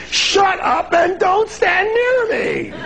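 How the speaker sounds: very high, drawn-out mock scream; hammed-up shout. Listener warding off a chronic complainer, hammed-up shriek. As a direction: shriek it in comic panic. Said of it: "so you can say".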